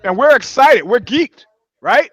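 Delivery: excited. Real, not acted. Host hyping the show to listeners, excited. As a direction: sell the excitement.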